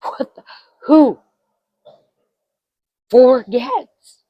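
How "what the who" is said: ordinary talk, nothing marked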